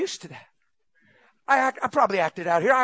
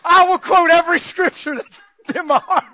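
higher, loud and laughing, with slow drawn-out words; fired-up laughing shout; 9 semitones higher, 8 dB louder, slower than usual. Real, not acted. Preacher vows to answer the devil by preaching, laughing and shouting. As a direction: roar it out in joyful defiance.

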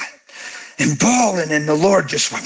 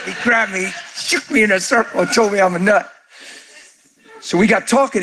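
ordinary talk, nothing marked

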